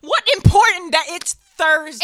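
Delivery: high and loud, ranting; loud mock outrage. Real, not acted. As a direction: rant it back at him.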